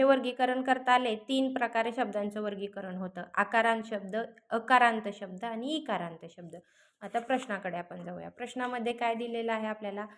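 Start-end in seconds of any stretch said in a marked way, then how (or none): none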